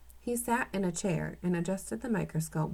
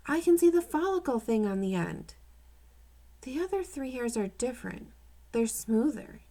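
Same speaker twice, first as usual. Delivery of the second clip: put-on boy's voice, lightly higher; young boy describing the hairs, lightly voiced; excited kid; 6 semitones higher than usual. A narrator's reading. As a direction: let the boy's excitement lift the voice. Child voiced in read story.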